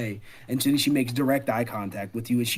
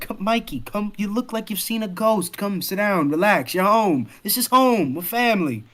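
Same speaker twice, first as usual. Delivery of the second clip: put-on higher-pitched girl urging a friend to settle; Sip, coaxing; 6 semitones higher than usual. Girl patting the bed beside her friend, coaxing. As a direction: coax them to sit and relax.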